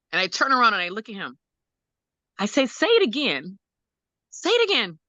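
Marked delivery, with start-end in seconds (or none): 2.7-5.0 retold urgent plea, rising much higher on the repeat. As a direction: urge a child to repeat it, pitch climbing. Mother urging child to repeat first word.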